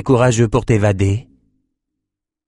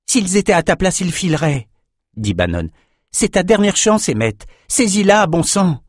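higher, pressing last-chance appeal; urgent Bannon; 8 semitones higher than usual. A narrator's reading. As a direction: push him hard to take the chance. Bannon urging Emmett.

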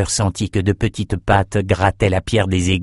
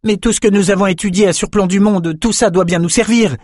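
put-on higher young woman's voice, insistent; Peretta's voice; 10 semitones higher than usual. A narrator's reading. Peretta arguing with Ambre, higher insistent voice. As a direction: insist their training must help. Peretta continues her objection.

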